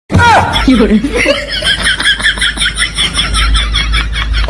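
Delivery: very high, loud mocking cackle; shrill cackle. The comic narrator ends the vegetable quarrel with a loud cackle. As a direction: finish with a wild cartoon cackle. Closing laugh of the sketch.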